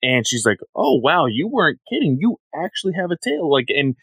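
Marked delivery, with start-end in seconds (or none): 0.7-3.5 higher-pitched acted surprise; mock amazement. Woman reacting to the boy's real tail, mock amazement. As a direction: retell it as a wide-eyed "oh wow".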